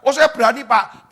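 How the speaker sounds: loud, high mock bravado; imitated confident outburst; bold reply. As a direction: answer up eagerly at full voice. Acted bold reply to his challenge.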